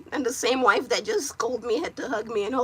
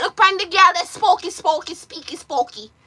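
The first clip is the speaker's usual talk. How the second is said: imitating teasing locals; 6 semitones higher, 6 dB louder than usual. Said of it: "they were like".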